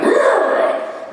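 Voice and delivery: gritted-teeth growl of a tough player. Retold or imitated. A tough footballer growls off an injury, hammed-up growl. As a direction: growl through the pain like an old-school player.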